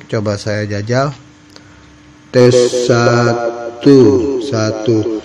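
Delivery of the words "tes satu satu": big echoing mic test; cathedral echo. Shown as a demonstration. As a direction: test count drenched in echo. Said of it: "kalau memang ini dipakai echo, suaranya cukup besar".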